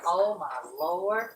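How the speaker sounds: ordinary delivery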